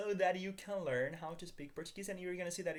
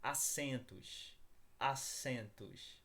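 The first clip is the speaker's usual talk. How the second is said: very slow, careful vocabulary model; slow model word; much slower than usual. Shown as a demonstration. Teacher modelling vocabulary for students, very slow. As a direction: stretch "assentos" out slowly, syllable by syllable, for learners to copy. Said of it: "Repeat after me".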